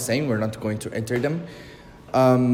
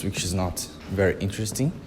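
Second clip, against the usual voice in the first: normal talking voice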